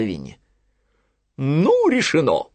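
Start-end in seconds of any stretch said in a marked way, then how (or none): 1.4-2.5 louder, raised owl decree; proclaiming Owl. Acted. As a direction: declare it settled like the owl. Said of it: "провозгласила сова". (торжественно провозглашает сова)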